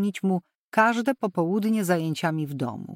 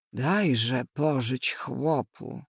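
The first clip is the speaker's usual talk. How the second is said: slow, lower pleading voice; 6 semitones lower, much slower than usual. A narrator's reading. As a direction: coax her gently, very slowly. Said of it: "zaczęła matka proszącym tonem".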